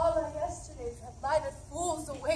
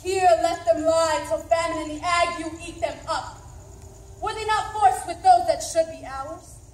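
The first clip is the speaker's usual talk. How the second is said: defiant shout; 5 semitones higher, 11 dB louder than usual. Acted. Macbeth defies the besieging army.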